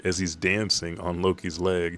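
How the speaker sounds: usual speaking voice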